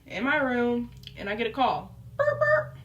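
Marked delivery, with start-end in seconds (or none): 2.2-2.8 high-pitched mouth-made ringtone; imitated phone ring. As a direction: mimic a phone buzzing, high.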